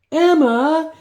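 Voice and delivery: very high, loud scream of her name; frightened scream. Acted. Brother seeing his sister fallen, loud high scream. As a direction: cry out in alarm. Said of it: "he screamed".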